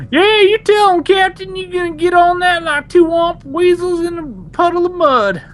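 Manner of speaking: very high, loud hammed-up sidekick; hollering Corso. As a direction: cheer the captain on in a loud, high put-on voice. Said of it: "and he's like".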